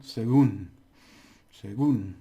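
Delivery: "según" with a loud, punched "gún"; stress on final syllable. Shown as a demonstration. Teacher showing Spanish for according to, stress on final syllable. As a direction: hit the "gún" of "según" hard. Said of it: "it's like these ones here".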